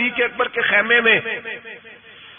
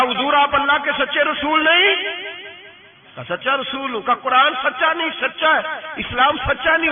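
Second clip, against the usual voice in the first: raised, insistent questioning; angry challenge; 6 semitones higher than usual. Retold or imitated. Umar confronting the Prophet over the treaty, indignant raised voice. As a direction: press each question hard like an indignant man.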